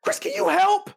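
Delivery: imitated high-pitched nagging; exasperated plea. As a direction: mimic a fed-up parent calling for help. Voicing exasperated mother asking help.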